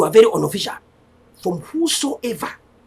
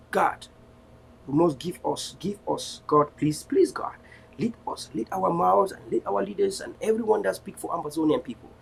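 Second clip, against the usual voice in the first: softer, earnest closing prayer; 4 semitones lower, 4 dB quieter than usual. Real, not acted. Leader praying for fellow Ambazonians, softer and earnest. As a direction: pray over your people, hushed and sincere.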